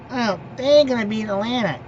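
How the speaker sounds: mocking hater. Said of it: "what y'all Patriot haters are thinking".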